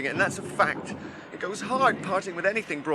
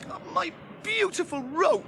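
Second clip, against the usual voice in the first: high, slow lament; mournful wail; 13 semitones higher, slower than usual. Acted. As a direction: wail over the lost rope. Lamenting the lost rope.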